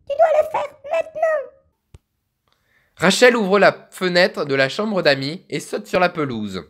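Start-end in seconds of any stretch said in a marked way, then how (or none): 0.1-1.5 put-on much higher, thin old woman's voice; little old lady voice. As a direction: squeak it out like a tiny grandma. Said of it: "on va faire une petite voix de vieille".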